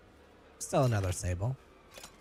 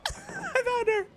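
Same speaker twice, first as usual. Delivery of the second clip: laughing; 3 dB quieter than usual. Real, not acted. Laughing relief.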